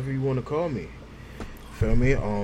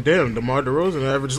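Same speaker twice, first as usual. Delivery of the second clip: ordinary talk, nothing marked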